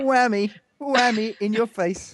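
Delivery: high gloating taunt. Real, not acted. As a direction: crow it way up high, rubbing it in. Gloating over winning argument.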